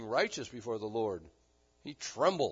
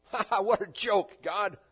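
loud, higher, acted laugh into the words; mock laughing scoff; 6 semitones higher, 6 dB louder than usual. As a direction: imitate Sarah laughing it off. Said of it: "Sarah goes out and laughs".

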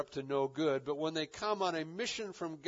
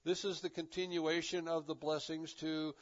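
ordinary delivery

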